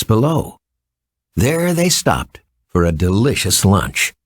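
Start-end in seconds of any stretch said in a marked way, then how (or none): none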